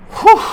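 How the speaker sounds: loud high exhale of relief; breathless whew. Real, not acted. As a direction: blow out a big breath, winded. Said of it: "yeah, I'm out of breath".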